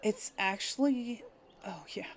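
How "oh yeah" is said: breathy whisper to herself, much softer; whispered aside. Real, not acted. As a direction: whisper the realization under your breath. Realizes own mistake, aside to self.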